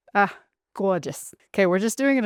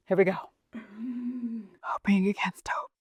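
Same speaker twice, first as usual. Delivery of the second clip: nervous whisper; 5 dB quieter than usual. Real, not acted. Nervous before release.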